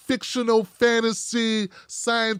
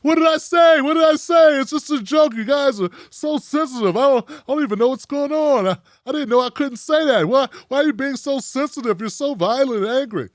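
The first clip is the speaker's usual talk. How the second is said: hammed-up clueless guy protesting he's so sensitive; mock innocent shout; 5 semitones higher than usual.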